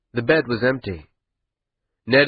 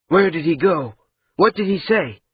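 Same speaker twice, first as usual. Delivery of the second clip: urgent pressing; 6 semitones higher than usual. Acted.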